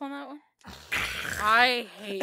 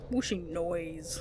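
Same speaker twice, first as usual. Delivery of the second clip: low, slow breathy whoosh; drawn-out whooshing sound effect; whooshing noise; 4 semitones lower, 6 dB quieter, slower than usual. Shown as a demonstration. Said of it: "A what?".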